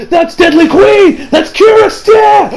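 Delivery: high, drawn-out mock cry of alarm; shocked shouting. Everyone recognising Deadly Queen, acted shocked shout. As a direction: yell it out like a stunned crowd. Everyone spots the enemy and cries out.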